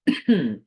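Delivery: sudden dry cough from a tickle in the throat; a sharp cough cutting off the sentence; real coughing fit. Real, not acted. Host breaks off her forecast to cough. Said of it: "little tiny dry spots in your throat".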